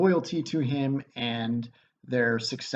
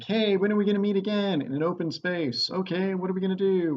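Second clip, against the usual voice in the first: lightly voiced witches, higher pitch; casual mock witches making plans, pitched up; witches chatting; 7 semitones higher, faster than usual. Three witches planning where to meet, lightly mimicked in a higher voice. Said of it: "three witches getting together and like".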